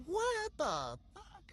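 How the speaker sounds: high, drawn-out disbelief; incredulous. Retold or imitated. Reacting to her rival's insults, incredulous. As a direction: stretch it out high, as if stunned.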